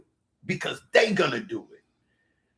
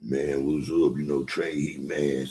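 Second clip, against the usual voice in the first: much lower, lightly voiced Trey; lower mock voice of Trey; 14 semitones lower, 3 dB quieter, slower than usual. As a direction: drop low to imitate Trey answering. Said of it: "He jump in".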